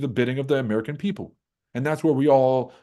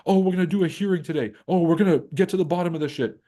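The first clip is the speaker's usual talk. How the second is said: high mocking politician voice; 6 semitones higher, faster than usual. Mocking politicians promising a hearing, sarcastic high voice. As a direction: sneer out the empty promises. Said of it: "it'll be like".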